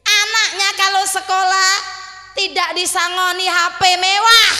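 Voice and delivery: fiery preaching. Acted. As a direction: preach it out to the crowd. Preaching at full force. (khotbah berapi-api, keras dan lambat)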